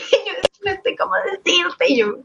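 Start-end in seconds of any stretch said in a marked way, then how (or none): 0.7-1.8 high-pitched mock tearful voice; near tears. Coworker who can't say it, mock near tears. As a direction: imitate someone almost crying, half laughing. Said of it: "Esa chica era casi llorando".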